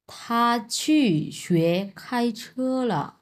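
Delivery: থা চু শুয়ে খাই ছে লা read very slowly, word by word; slow Chinese reading. Shown as a demonstration. Teacher reads the Chinese answer slowly. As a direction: lay out each syllable slowly. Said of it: "reading the answer".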